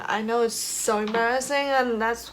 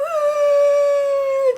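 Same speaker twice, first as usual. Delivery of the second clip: tearful laugh; 13 dB louder than usual. Real, not acted. Laughing through tears.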